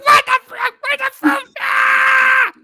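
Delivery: very high, loud outraged shriek; furious screaming. Retold or imitated. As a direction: scream it wildly, ending in a long wail. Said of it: "I was so mad".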